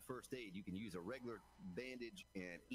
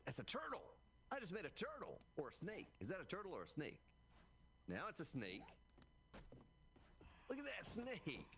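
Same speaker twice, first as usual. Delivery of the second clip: high, delighted squeals over a creation; giddy excitement; 10 semitones higher than usual. Real, not acted. Streamer showing chat his turtle build, giddy and high. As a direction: marvel at your own silly build. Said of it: "He's gone delirious".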